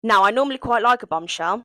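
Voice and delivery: ordinary talk, nothing marked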